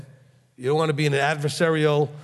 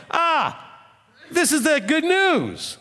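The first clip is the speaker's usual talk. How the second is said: delighted exclamation; 12 semitones higher, much slower than usual. Real, not acted. Exclaims in delight after reading.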